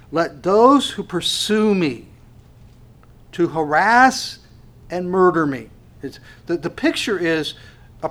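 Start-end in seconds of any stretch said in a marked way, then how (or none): 0.1-5.7 slow, louder proclamation; rephrased verse. Acted. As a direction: press every word home. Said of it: "if I can put it this way".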